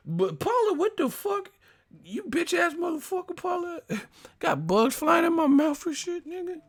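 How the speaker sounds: very high, squealed mock rant; freaked-out whine at the crew; breaking character. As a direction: imitate a reporter suddenly losing it. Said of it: "that motherfucker switched up so quick".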